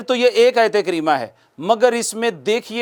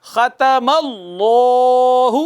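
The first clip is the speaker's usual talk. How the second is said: drawn-out tajweed recitation of ختم اللہ with heavy لام; slow recitation; 3 semitones higher, much slower than usual. Shown as a demonstration. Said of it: "تو دیکھیے".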